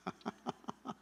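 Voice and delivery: soft, voiceless chuckle; quiet chuckling at own joke; laughter. Real, not acted.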